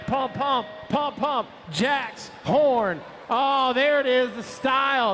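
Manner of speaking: high, excited announcer shouting pump; Chad impression. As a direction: hype it up like Chad calling the riders. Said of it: "That's my best Chad impression".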